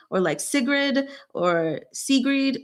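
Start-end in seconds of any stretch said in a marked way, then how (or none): 0.4-1.1 Sigrid with short i in both syllables; alternative pronunciation. Shown as a demonstration. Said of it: "how to pronounce your name". 1.9-2.6 Sigrid with long "ee" in both syllables; doubtful pronunciation attempt. Shown as a demonstration. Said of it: "Probably not Sigrid".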